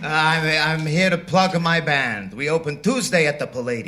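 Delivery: light mock Italian accent as Chico; Chico Marx. Chico answers the quizmaster in mock Italian accent.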